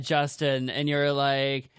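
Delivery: normal talking voice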